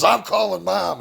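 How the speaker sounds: high, bratty imitation of a teen daughter; mock high tattletale voice; tattling daughter. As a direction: pitch up like a kid threatening to tell. Daughter voiced threatening to tell.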